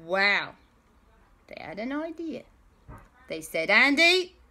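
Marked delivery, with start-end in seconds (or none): 3.7-4.4 calling out. Acted. Friends call to Andy.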